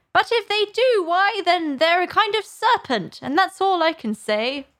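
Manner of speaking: high, agitated bird voice; scornful pigeon. A narrator's reading. Pigeon disbelieving Alice, scornful accusation.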